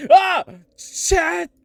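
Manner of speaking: high loud scream then shouting Chat; startled scream. Acted. Trapped character screaming for Chat's attention. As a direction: cry out in alarm.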